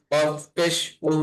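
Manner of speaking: normal talking voice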